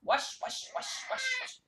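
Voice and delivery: swishy whispered washing noise; scrubbing sounds. Pretend. Acting out washing the cat, brisk swishy whisper. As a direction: make the word swish like scrubbing.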